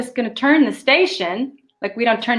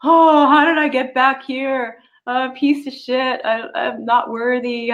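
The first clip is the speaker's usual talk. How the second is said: slow, higher mock wail; self-pitying lament; 4 semitones higher, slower than usual. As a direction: imitate your old despairing self, dragging it out. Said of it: "I'd be like".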